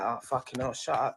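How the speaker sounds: low, soft inner grumble; thinking it under the breath; quiet annoyed inner voice; muttered in head. Retold or imitated. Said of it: "In my head, I'm like".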